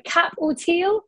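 put-on slow French accent on the horse name. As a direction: say Cap or Teal in a French accent.

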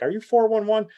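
high, light mock old lady asking; imitating grandma. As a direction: pipe up like an eager grandma on the phone. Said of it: "she would always ask".

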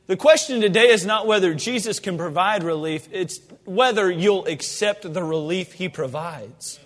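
ordinary talk

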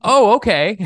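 hammed-up startled pushover voice; squeaky mock surprise. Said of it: "And he's like".

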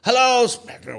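high, hammed-up hello into a mic; mock player greeting. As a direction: greet the school like a gushing star. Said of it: "He grabs the mic and he goes".

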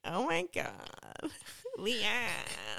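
slow mock LA drawl; vocal fry. Scolding the co-host after the bump, vocal fry. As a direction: creak out the dismay. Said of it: "this is the last vocal fry".

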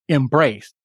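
"embraced" said alone, weight on BRACED; second-syllable stress. Shown as a demonstration. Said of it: "the accent falls on the second".

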